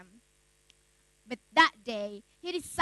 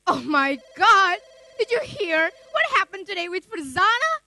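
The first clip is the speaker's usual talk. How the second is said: loud, breathless-with-news phone greeting; excited gossip; 3 semitones higher, 7 dB louder than usual. Acted. Friend phoning with shocking news about a classmate, excited. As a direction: burst out with scandalous news.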